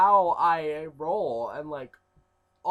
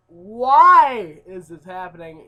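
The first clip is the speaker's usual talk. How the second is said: slow, drawn-out and much louder; stretched, loud despairing plea; exasperated wail; 15 dB louder, much slower than usual. Retold or imitated. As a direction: whine it out to mom. Calling mother, fed up.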